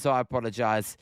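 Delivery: normal talking voice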